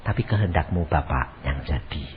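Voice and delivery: very soft, low submission; hushed, low yielding to the Father; surrendering prayer. Acted. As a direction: pray it quietly, giving in. Acts Jesus surrendering in prayer.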